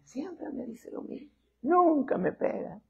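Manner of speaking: put-on high, soft, slow child's taunt; high soft kid voice mocking mom. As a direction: sneer like a kid who knows she won't hit. Child mocking mother's empty threats.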